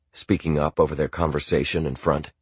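usual speaking voice

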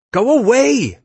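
very high, loud yell. A narrator's reading. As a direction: yell to drive the rescuer off. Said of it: "she yelled".

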